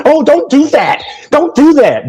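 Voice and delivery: high mocking whine; mock-whiny high imitation of the others. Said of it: "what did he put in y'all's food".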